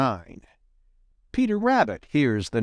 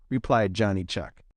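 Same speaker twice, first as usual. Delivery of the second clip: ordinary delivery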